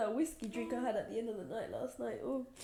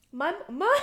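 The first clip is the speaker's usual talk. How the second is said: breaking into a laugh, much louder; 10 semitones higher, 13 dB louder than usual. Real, not acted. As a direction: laugh through the tears. Laughs while emotional.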